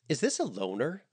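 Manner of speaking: bright, falsely cheerful question. A narrator's reading. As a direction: fake a cheerful tone. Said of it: "a strong dose of false cheer".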